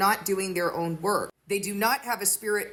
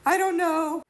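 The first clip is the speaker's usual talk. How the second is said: put-on much higher, louder small-child voice; upset little child; 10 semitones higher, 4 dB louder than usual. Child answering a puzzled parent, in a high mock-childlike voice. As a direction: play the kid who can't explain the tears. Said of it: "And they say".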